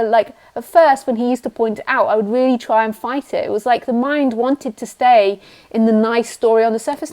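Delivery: normal talking voice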